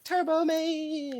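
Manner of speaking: high, long drawn-out cry; calling out Turbo Man. Acted. Heckling the film's hero, high mock cry.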